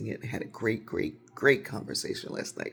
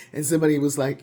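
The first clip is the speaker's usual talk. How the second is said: ordinary talk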